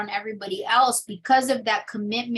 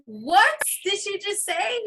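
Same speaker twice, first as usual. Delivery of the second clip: high, slow stunned question; hurt, disbelieving gasp of a line; shocked disbelief; 11 semitones higher than usual. Retold or imitated. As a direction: replay the shock of overhearing it. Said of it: "she kills me behind the wall, like".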